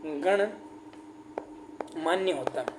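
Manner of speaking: very slow dictation. Shown as a demonstration. Teacher dictating गणमान्य very slowly and softly to students. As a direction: draw out गणमान्य very slowly so students can write it. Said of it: "क्या होता है साथियों आपके?".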